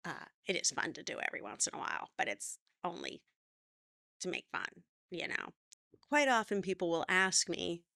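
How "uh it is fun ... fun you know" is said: vocal fry. Shown as a demonstration. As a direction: drop into a crackly fry. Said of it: "so that you're not sounding like this".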